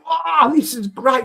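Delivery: high, slow, loud delighted student; mock-excited American student tasting; delighted kid. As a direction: savour it like a rare treat. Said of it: "they're chomping into it and going".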